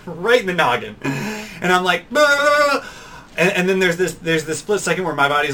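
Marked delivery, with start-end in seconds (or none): 2.1-2.8 sudden high, loud cry of shock; startled yelp. Retold or imitated. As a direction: jolt as if just hit. Reacting to being punched.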